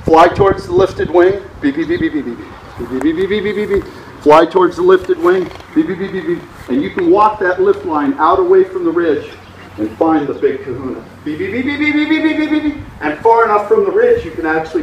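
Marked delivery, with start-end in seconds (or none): 1.6-3.8 soft rapid beeps in two bursts; imitated variometer beeps. Shown as a demonstration. Imitates variometer along lift line. 5.7-6.4 brief soft burst of quick beeps; imitated variometer beeps. Shown as a demonstration. Imitates variometer along lift line. 11.2-12.8 soft rapid beeps climbing very high; rising variometer beeps. Shown as a demonstration. Imitates variometer finding strong lift.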